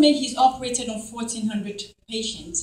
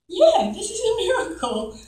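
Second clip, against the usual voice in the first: retold reply, pitched way up; high-pitched agreement; 11 semitones higher, 4 dB louder than usual. Mother answering the surgeon after laughing, high-pitched agreement. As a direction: agree in a much higher voice.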